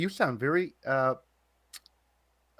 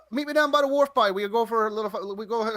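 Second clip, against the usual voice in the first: high, playful Newfoundland demo; Newfoundland accent; 8 semitones higher, 4 dB louder than usual. Shown as a demonstration. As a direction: lay on the Newfoundland wharf talk. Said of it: "that's exactly what it's like".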